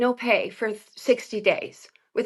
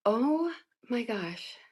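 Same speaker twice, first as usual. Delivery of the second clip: drained; 10 dB quieter, slower than usual. Retold or imitated. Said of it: "I had nothing left".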